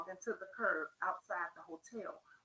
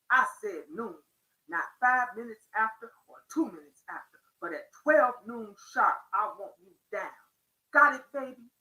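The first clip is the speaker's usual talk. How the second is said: lightly voiced pimp laying down the law, louder; pimp's voice; 8 dB louder than usual. A narrator's reading. Pimp telling Kim he wants her down at noon sharp, lightly acted stern orders. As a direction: give firm, emphatic orders in a lightly played pimp voice. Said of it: "I hope y'all like my voice".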